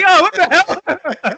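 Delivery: high, loud mock shock through laughter; shocked disbelief. Director learning the actor is British, mock shocked disbelief. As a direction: exclaim it high, half laughing.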